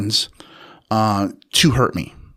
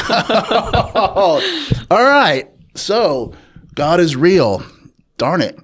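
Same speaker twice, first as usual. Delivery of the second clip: laughing disbelief; 8 semitones higher, 3 dB louder, much slower than usual. Retold or imitated. Shocked, amused reply to dad.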